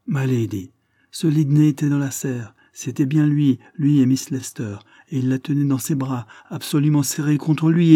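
a servant gasping out a scandal to her lady; scandalized telling. A narrator's reading. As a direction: rush out the shocking gossip. Servant breathlessly reporting scandal.

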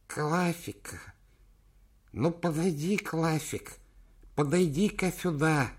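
high, slow mumbling elder; frail old man. Acted. Decrepit old chairman voiced.

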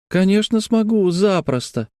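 put-on high, confident child voice; cocky little girl. A narrator's reading. Girl assures Grant she can climb over, high cocky voice. Девочка хвастливо отвечает Гранту.

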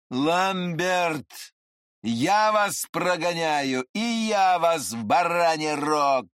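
slow, drawn-out bellowed threat; Andreev's mocking shout. A narrator's reading. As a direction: throw the threat back, stretching each word. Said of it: "крикнул Андреев".

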